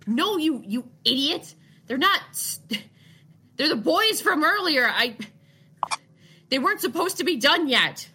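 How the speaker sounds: high, loud, frantic scolding. Acted. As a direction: snap at the idiot in a panic. GM voices panicked NPC.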